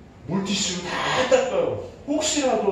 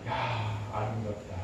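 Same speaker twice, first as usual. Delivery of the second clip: admiring; 10 semitones lower, 9 dB quieter, slower than usual. Retold or imitated. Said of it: "만드시고는".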